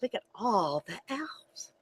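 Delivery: unvoiced aside whisper. Real, not acted. Aside to children while reading.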